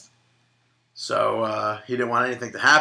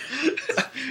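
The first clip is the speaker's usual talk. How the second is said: real laughter; 5 dB quieter than usual. Real, not acted. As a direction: burst of high laughter. Laughing at the pork pun.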